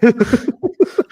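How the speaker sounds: a sudden, high-pitched laugh; high burst of laughter. Real, not acted. Laughing at the idea of doing an accent, high laugh.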